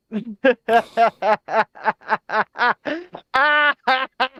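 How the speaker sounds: high, loud cackling laugh that cracks; stupid laugh. Real, not acted. Reading a message from David Nolan, bursting into a cracking laugh. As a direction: laugh until the voice goes. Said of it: "your voice goes when you do that stupid laugh".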